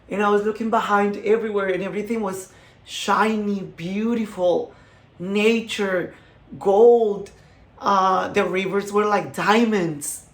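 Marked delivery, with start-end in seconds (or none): none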